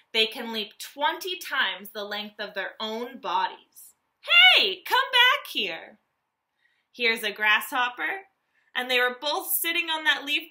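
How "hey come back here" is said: high, slow calling out after a jumper; playful call. Pretend. Teacher voicing a grasshopper for kids, playful high call. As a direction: call after the escaping grasshopper, much higher.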